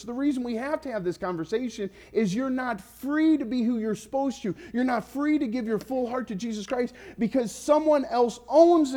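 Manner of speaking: high-pitched, forceful appeal, key words punched; impassioned preaching. Real, not acted. Preacher urges congregation to be free of money's rule, impassioned preaching. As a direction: plead with the congregation at full pitch.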